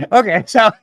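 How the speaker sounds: rindo enquanto fala; laughing. Real, not acted. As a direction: riso solto antes de seguir. Reacting to guest's joke.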